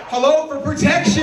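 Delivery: high-pitched sassy comeback; indignant girlfriend. Acted. Girlfriend defending the gun, high indignant retort.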